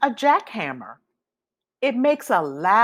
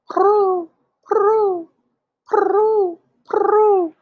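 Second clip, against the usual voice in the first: much higher, louder cooing "prue"; pigeon coo; 12 semitones higher, 5 dB louder, much slower than usual. A narrator's reading.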